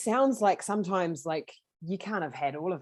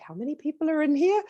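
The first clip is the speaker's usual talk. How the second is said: amazed; 11 semitones higher, 6 dB louder than usual. Retold or imitated. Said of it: "I'd be like".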